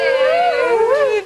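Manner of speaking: loud, high, drawn-out whoop; big high "woo" from the host; cheering whoop. Real, not acted.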